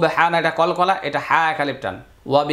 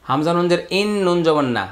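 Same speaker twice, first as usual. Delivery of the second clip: ordinary talk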